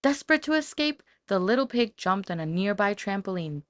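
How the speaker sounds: ordinary delivery, nothing marked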